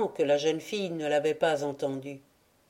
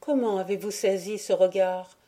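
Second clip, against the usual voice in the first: usual speaking voice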